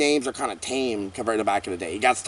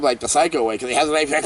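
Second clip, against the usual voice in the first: ordinary delivery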